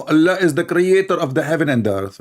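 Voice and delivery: high, loud, overexcited mock shout; mock screaming preacher. Mocking the video preacher, loud excited shout. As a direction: ham up a frantic screaming preacher. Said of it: "This guy is screaming".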